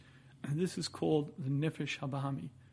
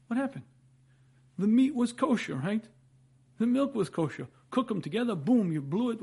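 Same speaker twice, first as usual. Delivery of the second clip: high, loud, incredulous; astonished; 6 semitones higher, 6 dB louder than usual. Acted. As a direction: act amazed at the puzzle. Mock astonishment at the paradox.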